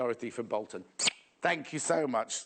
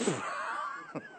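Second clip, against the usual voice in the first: breathy burst of real laughter at the joke; helpless real laugh; 5 dB quieter than usual. Real, not acted. Laughing at viewer's message.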